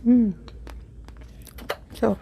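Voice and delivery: normal talking voice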